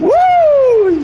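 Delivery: high, loud celebratory woo; excited whoop. Real, not acted. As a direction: let out a big cheer. Celebrating a play in the game.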